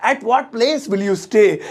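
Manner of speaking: slow, clear English; teacher modelling the sentence; spaced-out words; slow model sentence. Shown as a demonstration. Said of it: "तुम किस जगह रहोगे".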